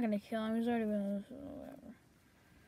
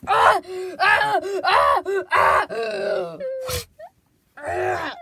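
loud repeated agonized screams; dying screams; 6 semitones higher, 8 dB louder, much slower than usual. Acted. Victim screaming while being killed. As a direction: scream as you are killed.

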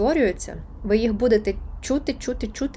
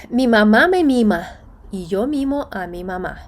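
measured Spanish recitation, starting loud then settling; slow tongue twister; 6 dB louder than usual. Shown as a demonstration. Teacher reading the next tongue twister, slow. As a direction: model the twister slowly for a learner. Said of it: "я їх буду читати повільно".

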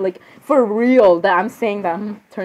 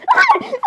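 very high, short squeal of laughter; high shrieking laugh. Acted. Speaker reacting in shock to girlies, shrieking laugh.

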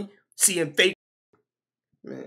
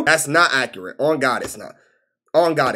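ordinary delivery

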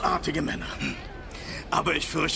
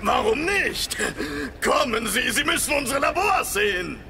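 eager excitement; 9 semitones higher than usual. Acted. Eager host shows off labs.

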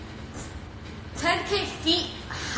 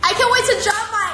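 excited laughing; 6 semitones higher, 7 dB louder than usual. Real, not acted.

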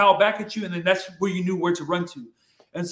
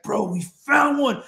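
awed slow announcement; 4 semitones higher, much slower than usual. Retold or imitated. Disbelief at finding it.

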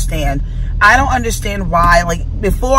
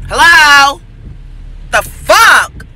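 exasperated hello; 7 semitones higher, much slower than usual. Real, not acted. Annoyed at other driver.

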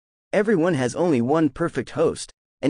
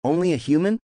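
higher, disbelieving echo; incredulous scoff; 4 semitones higher, faster than usual. A narrator's reading. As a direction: throw the words back in disbelief. Thor scoffs at son's words.

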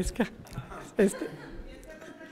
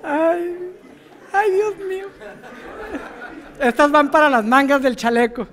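high-pitched giggling words; laughing helplessly through the words; 6 semitones higher, 3 dB quieter than usual. Real, not acted. Laughing fit during roll call. (hablando entre risas)

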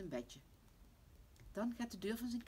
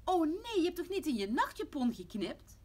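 loud, high-pitched dismay; dismayed scolding; 12 semitones higher, 9 dB louder than usual. Acted. Mama sees the cut nightgown, loud dismayed scolding. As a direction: exclaim like an appalled mother.

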